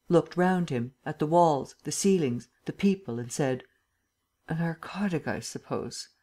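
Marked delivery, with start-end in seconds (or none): 4.5-6.1 weak, whispery, trailing away; just waking. A narrator's reading. Lupin wakes and looks at the people, groggy murmur. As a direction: murmur it softly while coming round. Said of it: "he seemed to wake".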